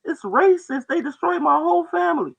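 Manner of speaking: high, loud mock-indignant voice; mock outraged whiner. Mocking a complainer, high and loud mock outrage. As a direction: whine it in a high put-on pitch.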